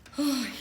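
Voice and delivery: big theatrical sigh; heavy sigh. Acted. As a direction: sigh like trouble is coming. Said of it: "oh boy".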